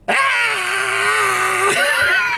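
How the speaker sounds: high shrill imitated stab scream; mock scream. Mocking the actress, a hammed-up scream. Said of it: "she's like".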